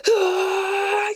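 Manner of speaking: sustained high-pitched imitated cry; high drawn-out "aah". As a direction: let out a long, high mock battle cry. Imitates her reaction mid-fight.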